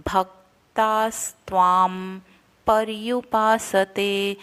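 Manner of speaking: slow भक्तास्त्वाम पर्युपासते, half स and त clear; careful syllables. Shown as a demonstration. Teacher reciting the word slowly for practice. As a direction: sound the half letters and the r before य. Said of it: "य पर छोटे उ की मात्रा है और ऊपर रफा".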